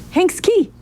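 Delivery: high-pitched jolt of discovery; excited realisation. Acted. Searcher suddenly spotting the key in a photo, excited. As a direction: pitch leaps up as it clicks.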